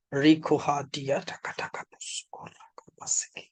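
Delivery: tongues sinking into a breathy whisper; fading into whisper. Real, not acted. Preacher praying in tongues over listeners, fading to a whisper. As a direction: start voiced, drop to an unvoiced murmur.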